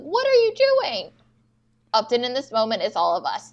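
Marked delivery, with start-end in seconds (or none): 0.0-1.1 very high, drawn-out mock yell; pissed-off yell. Retelling Upton confronting Jay, pissed-off high yell. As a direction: imitate an exasperated yell, pitched way up. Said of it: "she, like, yells it. She's pissed".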